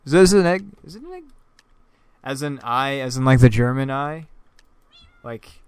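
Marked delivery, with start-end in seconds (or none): 0.1-1.2 surprised question. Real, not acted. Puzzled at a drawing.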